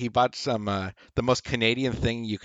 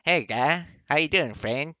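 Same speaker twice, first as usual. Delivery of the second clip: higher-pitched mock Canadian accent; Canadian speak; 5 semitones higher than usual. Shown as a demonstration. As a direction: try out a cartoon Canadian greeting. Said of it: "way Canadians speak? Is it".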